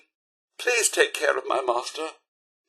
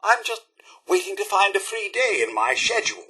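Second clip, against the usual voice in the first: normal talking voice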